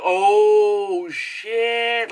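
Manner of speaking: high, drawn-out dawning shock; mock shocked realization. Viewer finding out the mistress was a plant, mock shocked. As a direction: stretch the 'oh' high and loud. Acts out viewer discovering the twist.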